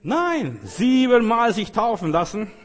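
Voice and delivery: high, loud preacher's insistence; emphatic rejection. Real, not acted. Preacher rejecting the easy blessing, emphatic.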